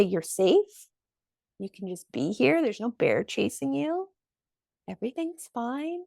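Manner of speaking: calm a frightened body, hushed and gentle; soothing. Pretend. Telling your own brain you're safe, in a soft soothing hush.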